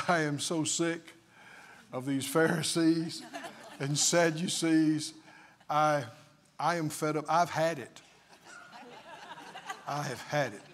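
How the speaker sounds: mock depressed Jesus. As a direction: play Jesus fed up with Pharisees, half laughing. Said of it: "And he said".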